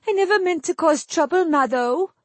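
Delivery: high, weepy pleading; tearful wail. A narrator's reading. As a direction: sob through the apology. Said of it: "moist sobs".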